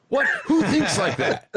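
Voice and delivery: laughing disbelief. Real, not acted. Amused disbelief at themselves.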